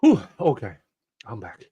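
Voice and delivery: breathless after hot sauce, mouth burning; mouth on fire. Real, not acted. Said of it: "My mouth is on fire".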